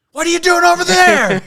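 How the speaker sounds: high, loud yell across a river; ranger yelling. Retold or imitated. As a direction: shout it across the water at a stranger.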